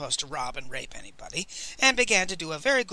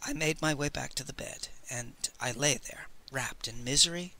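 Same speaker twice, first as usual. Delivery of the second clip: quiet despair; 4 semitones lower, 7 dB quieter than usual. A narrator's reading. Narrator sinking into despair.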